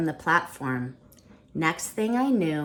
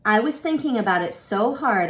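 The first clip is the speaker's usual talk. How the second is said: ordinary delivery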